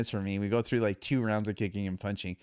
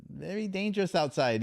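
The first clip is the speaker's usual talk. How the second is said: imitating Thai trainer, worried; 9 semitones higher than usual. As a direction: warn him gently in the trainer's accent. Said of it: "He said".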